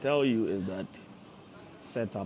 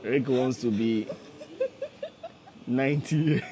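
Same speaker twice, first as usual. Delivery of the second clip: laughing joke; 4 semitones higher, 3 dB louder than usual. Real, not acted. Host joking that his son will marry at ninety, laughing. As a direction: let the laugh take over the last words.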